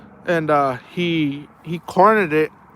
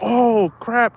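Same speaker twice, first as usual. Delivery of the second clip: startled oh crap; 7 semitones higher than usual. Retold or imitated. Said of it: "I was like".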